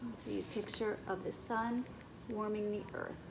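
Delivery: usual speaking voice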